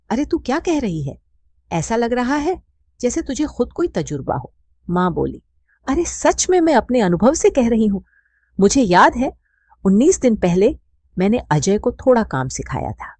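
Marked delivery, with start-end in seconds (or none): 0.1-4.5 raised, startled woman's voice; taken aback, asking sharply. A narrator's reading. Radha startled by her friend's advice, higher and surprised. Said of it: "Radha चौंक कर बोली". 5.9-13.1 loud, high, insistent; emphatic insisting. A narrator's reading. Mother insists to her friend from experience, loud and emphatic.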